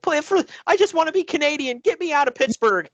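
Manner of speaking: high, pleading mock plea; mock begging. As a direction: beg like a desperate man, hammed up. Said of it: "And he's like".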